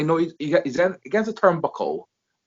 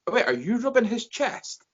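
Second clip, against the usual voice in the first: incredulous question; 9 semitones higher, 4 dB quieter than usual. Retold or imitated. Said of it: "It's like".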